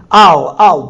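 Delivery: loud, pained plea; pleading cry. Acted. Teacher voicing the psalmist's plea, loud and pained. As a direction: cry it out as a desperate prayer.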